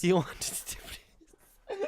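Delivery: high squeal, very slow, laughing. Real, not acted. Friends laughing over a Limerick quote. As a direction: crack up helplessly.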